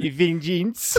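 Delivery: "vengeance" mimicked high and drawn out; mimicked pronunciation. Teasing co-host over how they said it, mocking mimicry of "vengeance". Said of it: "Did you notice how you said".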